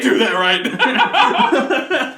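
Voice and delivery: high, loud, slow laughing plea; mock-pleading through laughter; laughing whine. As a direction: whine it while cracking up. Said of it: "She's like".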